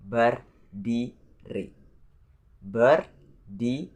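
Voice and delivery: berdiri slowly split into ber... di... ri; syllable by syllable. Shown as a demonstration. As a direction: say berdiri in three separate pieces. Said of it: "There are three syllables in this word".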